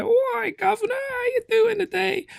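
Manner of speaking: very high, hammed-up British chimney sweep; nineteen-twenties chimney sweep. Husband imitates wife's British accent, hammed-up chimney sweep. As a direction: do a squeaky mock cockney sweep's accent. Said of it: "She does a nineteen twenties chimney sweep".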